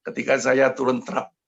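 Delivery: normal talking voice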